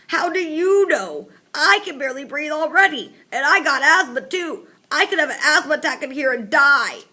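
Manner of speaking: very high, loud breathless panic; panicked whine. A narrator's reading. Mouth breather fears asthma attack, loud high panic. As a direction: gasp it out in rising panic.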